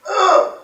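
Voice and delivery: sharp high cry of pain; pain grunt. Retold or imitated. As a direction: yelp as the shot hurts. Kid feeling the pain.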